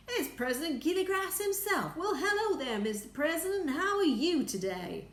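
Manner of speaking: high, eager, fawning greeting. Acted. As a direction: fawn over the President. Shanks greeting the President sweetly.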